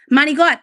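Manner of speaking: "manigot" said "mah-nee-gut", punchy and loud; "manigot" hit hard, no final vowel; Italian-American manigot. Shown as a demonstration. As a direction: belt out "manigot" with the end clipped. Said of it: "It's not manicotti, it's manigot".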